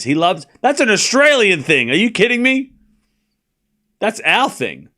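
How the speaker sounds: high-pitched, incredulous objection; indignant protest. Real, not acted. Australian streamer claims meat pies from the British, indignant protest. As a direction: protest it in rising disbelief.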